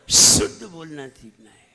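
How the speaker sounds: "शुद्ध" with a very loud sustained sh; teacher's forceful whistling sh opening "शुद्ध"; whistled sibilant. Shown as a demonstration. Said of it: "अगर सीटी नहीं आती है".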